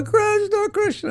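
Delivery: high, ecstatic cry of the name; mock holy man crying out the name; faked ecstasy. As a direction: ham up a fake rapture. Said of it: "this guy's going".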